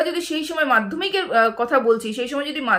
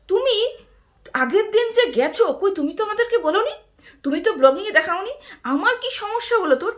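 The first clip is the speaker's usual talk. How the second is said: mock angry quote; 6 semitones higher than usual. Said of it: "ঢেঁড়স এইবার খুব রেগে গেল, রেগে গিয়ে বলল".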